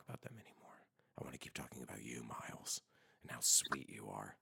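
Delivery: fast, soft, breathy-free whisper, teasing; hushed teasing whisper to Miles; mock flirty whisper. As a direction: whisper sweet nothings as a joke.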